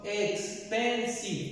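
EXPENSIVE slowly split as ex-pen-sive; syllable by syllable. Shown as a demonstration. Teacher counting syllables for students, "expensive" said in separate beats. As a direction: break "expensive" into three slow, separate syllables. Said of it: "tiene tres sílabas".

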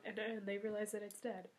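usual speaking voice

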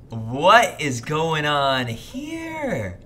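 very slow, stretched-out bewilderment; baffled. Real, not acted. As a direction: drag out every word, swooping up on the last. Baffled reaction to the game.

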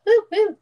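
two high, loud woo sounds; short high-pitched "woo woo"; playful whoops. Real, not acted. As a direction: let out a bright pair of woos, high and loud. Playful whoops while setting up.

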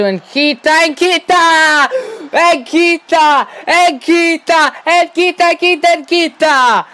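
screaming the scorer's name, high and loud; ecstatic goal scream. Real, not acted. Gamer screaming as his striker scores. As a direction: yell his name over and over in triumph.

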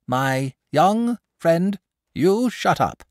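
severely. A narrator's reading. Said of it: "said the dragon severely".

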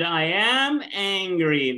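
"I am angry" said very slowly and clearly, word by word; slow it right down for the students to repeat; slow model sentence. Shown as a demonstration. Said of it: "Sound very good".

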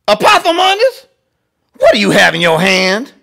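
high, loud mother's voice scolding her son; high scolding mama. Acted. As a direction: call him out like a fed-up mother.

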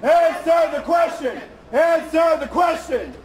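slow, insistent shouting demand; shouting match. Real, not acted. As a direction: hammer each word, answer me. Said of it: "getting into a shouting match".

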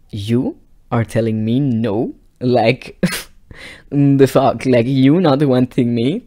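loud, slow, outraged disbelief; indignant disbelief. Retold or imitated. Girlfriend rejected in bed, loud outraged disbelief. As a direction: blast it like she can't believe the refusal.

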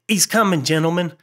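high, urgent breathless announcement. A narrator's reading. Waiter bursting in to warn the men, breathless. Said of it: "he said breathlessly".

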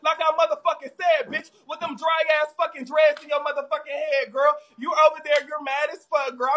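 high-pitched, rapid-fire insult tirade; trash-talk at a high pitch; hyped-up roast. Pretend. Roasting an opponent on live stream.

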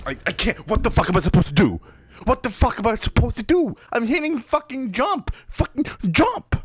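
high, fast panicked yelling; panicking gamer. Real, not acted. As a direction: panic as the game goes wrong. Panicking over failing jump.

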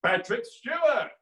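high, drawn-out theatrical impression; Patrick Stewart. Shown as a demonstration. Answering what's for dinner, a slow high actor impression. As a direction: stretch the name out grandly, well up in pitch. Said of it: "to do a Patrick Stewart impression is to say".

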